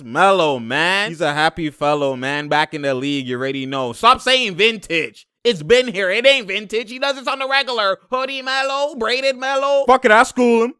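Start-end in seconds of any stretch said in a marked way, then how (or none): 3.9-10.7 higher, louder excited insistence; hyped rant. Real, not acted. Defending Melo to viewers, loud and hyped. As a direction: preach it to the doubters.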